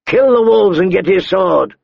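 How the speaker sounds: high-pitched shouted order. A narrator's reading. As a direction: call the command across to her. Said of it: "He raised his voice".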